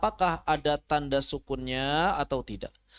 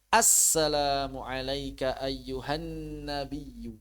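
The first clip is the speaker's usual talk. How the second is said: slow careful recitation; slower than usual. Shown as a demonstration. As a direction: recite slowly, each syllable drawn out. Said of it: "Hati-hati lagi sholat". (bacaan tahiyat pelan dan hati-hati)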